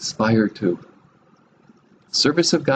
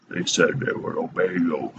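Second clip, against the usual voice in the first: low, softer, gravelly talking-rock voice; gravelly cartoon rock; 5 semitones lower, 5 dB quieter than usual. The rock testifying to God, in a lightly gravelly cartoon voice. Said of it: "you know, gravelly voice".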